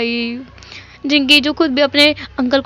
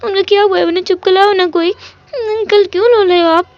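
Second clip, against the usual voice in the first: put-on high, tearful toddler voice with a lisp; little kid sobbing his questions; crying little boy; 5 semitones higher than usual. Small child cries and asks his uncle why he is crying.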